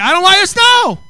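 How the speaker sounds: high, loud mock grumble; loud complaint. Pastor telling the church he hates snow, loud mock whine. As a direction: whine it out big for laughs.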